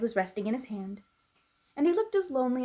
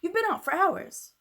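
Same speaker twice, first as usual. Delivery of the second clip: much higher, louder scolding headmistress voice; prim scolding voice, pitched up high; stern headmistress; 10 semitones higher, 4 dB louder than usual. Acted. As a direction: scold her like a strict schoolmistress. Miss Minchin scolding Sarah.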